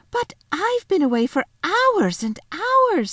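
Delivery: put-on high, drawn-out child's protest; insistent protest. Lucy insisting she was long away, protesting. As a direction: wail it, stretching the repeated word.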